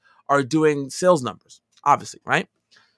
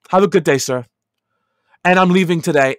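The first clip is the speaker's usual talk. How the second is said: retold defiant exit; 3 semitones higher, 7 dB louder than usual. Quitting, telling off director.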